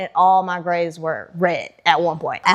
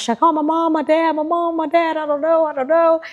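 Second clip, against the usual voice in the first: anxious indecision; 8 semitones higher than usual. Retold or imitated. Anxious inner debate, much higher.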